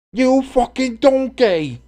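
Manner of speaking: high, loud, stretched-out mock English shout; badly faked angry English chef insult; Gordon Ramsay English accent. Streamer tries a chef's insult, hammed-up English accent. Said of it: "I can't do an English accent".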